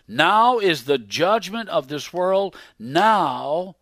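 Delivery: slow, very loud pulpit shout, pitched high; full-force preaching roar; thundering proclamation. Acted. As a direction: thunder the judgment at the congregation.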